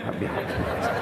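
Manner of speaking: a soft secret prayer whispered close. Shown as a demonstration. Father whispering a prayer over his child.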